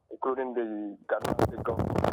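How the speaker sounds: normal talking voice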